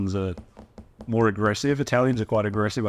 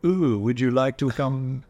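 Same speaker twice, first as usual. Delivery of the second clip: slow mock-seductive French accent; sexy French accent; 3 semitones higher, slower than usual. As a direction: draw it out in sultry French-accented English. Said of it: "non-sexy things that sound sexy".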